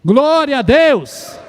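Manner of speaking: a solid but held-back shout of praise; first shout, not yet full force; loud praise shout. Shown as a demonstration. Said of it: "Vamos falar glória a Deus em alta voz?".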